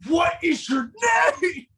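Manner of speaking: high, hammed-up shout; mock-furious yell; yelled demand. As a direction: bellow it like mid-headbutt. Suggested headbutt with yelled demand.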